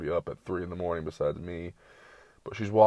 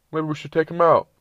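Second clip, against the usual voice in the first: higher, louder mock suggestion; worried owner; 9 semitones higher, 8 dB louder than usual. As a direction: say it like a fretting family member. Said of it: "you're like".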